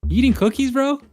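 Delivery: high, loud disbelief; incredulous, catching him eating; surprised. Real, not acted. Catching friend eating cookies.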